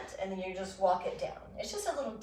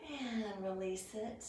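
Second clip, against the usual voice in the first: ordinary talk, nothing marked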